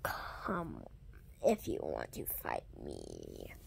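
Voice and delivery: low, hushed dare to fight; whispered challenge. Acted. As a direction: whisper the dare close and soft.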